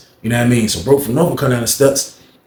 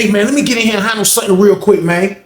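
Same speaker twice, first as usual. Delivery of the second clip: urgent request; 8 semitones higher than usual. Retold or imitated. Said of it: "he said".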